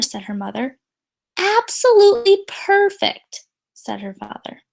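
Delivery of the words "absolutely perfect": reassuring. Acted. Father reassures daughter.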